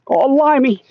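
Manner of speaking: high-pitched, badly faked New Zealand accent; hammed-up New Zealand accent sliding towards Cockney; terrible New Zealand accent. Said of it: "terrible New Zealand accent".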